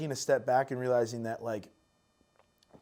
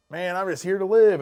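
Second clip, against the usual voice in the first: laid-back hippie, higher and louder; 6 semitones higher, 8 dB louder than usual. Retold or imitated. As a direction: drift it out like a carefree hippie. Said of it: "I feel like I sound like a, like a hippie right now".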